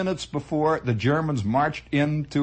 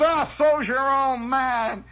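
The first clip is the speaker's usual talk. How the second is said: higher, slow jeering taunt; mock insult; 8 semitones higher, slower than usual. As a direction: sneer at the Frenchman. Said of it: "somebody came in and says".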